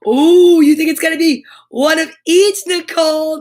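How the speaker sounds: loud delighted ooh; bright, loud and delighted; excited. Real, not acted. Reacting to guess.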